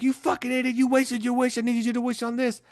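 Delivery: high-pitched retold berating; exasperated scolding. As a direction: act out a friend's indignant rant. Friend berates him in retold quarrel.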